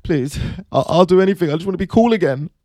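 hammed-up begging, half-crying; mock crying plea. [name] begs [name] to make him cool, mock sobbing. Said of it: "Will Smith's crying".

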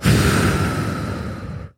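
long, audible exhale; breath between statements. Shown as a demonstration. Coach acting out breathing between affirmations, long exhale. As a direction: breathe all the way out in a steady sigh. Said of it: "we're gonna breathe".

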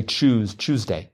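"Tuesday" with a ch, like choose; Tuesday as CHOOSE-day. Shown as a demonstration. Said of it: "people will say".

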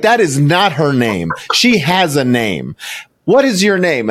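slow, high, emphatic indignation; mock scolding. Woman correcting him about the name, slow mock outrage. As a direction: act out a stranger's outraged correction, word by word. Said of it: "chewed me out".

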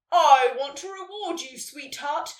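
big, loud opening, easing off; booming Santa. A narrator's reading. Santa rewarding Nelly, booming. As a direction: boom it out generously. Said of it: "Santa boomed".

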